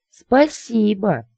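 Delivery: high, long-drawn squeal; thin squeally lady. A narrator's reading. Lady thanking her suitor for a kiss, squeally. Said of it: "тонкий визгливый голосок".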